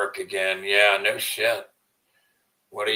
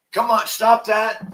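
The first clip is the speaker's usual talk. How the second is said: higher, louder, slowed scold at the pet; calling the dog off, higher and louder; 12 semitones higher, 6 dB louder than usual. Real, not acted. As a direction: snap the dog out of it.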